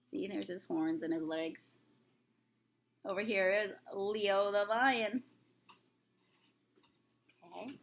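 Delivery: usual speaking voice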